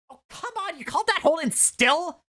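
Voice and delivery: put-on high New York accent, scolding. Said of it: "Miss Dona scolded".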